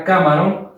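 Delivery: "camarón" said as CÁ-maron, first syllable stressed. Shown as a demonstration. Teacher rejecting a nonexistent word, "camarón" stressed as CÁmaron. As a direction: put the weight on CA in "camarón". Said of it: "Comencemos con el K".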